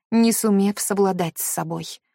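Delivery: usual speaking voice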